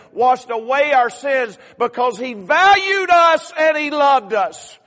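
loud, high, slow, building to a shout; preaching at full force. Acted. Pastor to church, preaching Jesus's sacrifice, building to a loud shout. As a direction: preach it to the rafters, slowing on each phrase.

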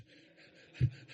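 breathy, voiceless laughing; wheezy chuckle; rueful laugh. Real, not acted. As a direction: laugh at your own bad memories. Laughs at his own bad memories.

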